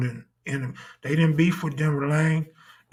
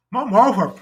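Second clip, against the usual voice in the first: pitiful higher whimper of complaint; higher whiny complaint; 5 semitones higher than usual. Retold or imitated. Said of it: "Tell me".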